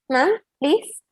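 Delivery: usual speaking voice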